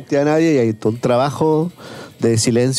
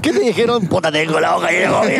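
mocking imitation; 3 semitones higher than usual. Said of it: "Te das cuenta que es chabacán".

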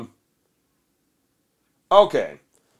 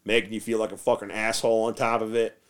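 normal talking voice

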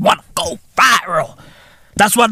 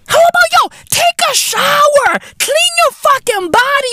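shrill-high mock voice, hooting the advice way up high; high mocking squeal. Ranting at girls online, high mocking squeal. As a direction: squeak it out like a cartoon scold.

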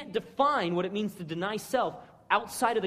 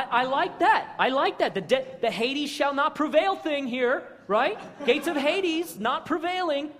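Peter protesting; 6 semitones higher, 4 dB louder than usual. Retold or imitated.

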